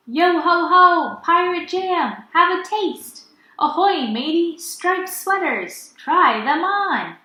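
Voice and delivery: high, loud and slow hawker's cry; market calling. Acted. Pirates selling jam at the busy market, loud hawker's cry. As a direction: call out to the crowd like a pirate trader.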